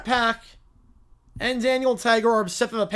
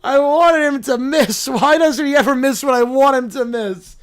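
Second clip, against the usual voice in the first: frustrated wail; 5 dB louder than usual. Acted. Child watching the player score, frustrated wail. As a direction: wail it out in protest.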